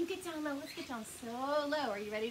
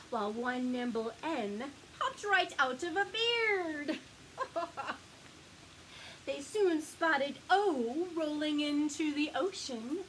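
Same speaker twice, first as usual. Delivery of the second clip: ordinary delivery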